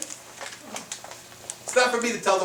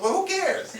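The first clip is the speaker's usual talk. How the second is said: mock dismissive; slower than usual. Dismissing the friend.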